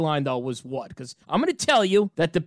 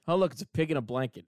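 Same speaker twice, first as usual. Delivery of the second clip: low, quick teasing voice; a hint of a mocking wife; soft mock wife; 8 semitones lower, 7 dB quieter, faster than usual. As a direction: tease it like the wife. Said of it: "she always says".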